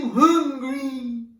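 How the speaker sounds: high, very loud, slow whinge; whingy dragon. Acted. Dragon surprising a little girl, in a very whingy voice. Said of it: "in a very whingy voice".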